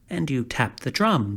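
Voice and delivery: ordinary delivery, nothing marked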